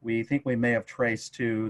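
usual speaking voice

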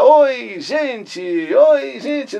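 high, loud, bright-announcer "Oi, gente"; signature radio greeting. Shown as a demonstration. Radio host greeting his listeners, high and loud. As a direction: sing out the greeting to the whole audience. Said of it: "eu comecei a falar".

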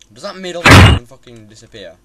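loud exasperated question; frustrated shout. Real, not acted. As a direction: demand it in annoyance.

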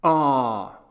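put-on loud boy's exclamation; impassioned childish cry. A narrator's reading. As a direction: burst out with a loud sigh of protest. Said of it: "cried Roland".

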